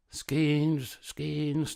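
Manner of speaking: high taunting chant of skins; mocking cry. A narrator's reading. Townsmen jeering at Great Claus, mocking cry. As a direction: jeer it out like a mob. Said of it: "they cried, mocking him".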